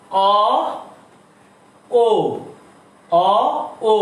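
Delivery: অ then ও, lips rounding tighter on ও; vowel demo. Shown as a demonstration. Bengali teacher demonstrating the vowel contrast. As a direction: sound out অ and ও slowly, one by one. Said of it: "ও বলার সময় অ এর চেয়ে ঠোঁট একটু সংকুচিত হলো".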